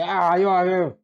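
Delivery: loud, high, pushy outburst; angry intruder. Retold or imitated. A man cutting the queue bursts out angrily. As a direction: barge in with an indignant yell. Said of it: "llega alguien enojado y dice".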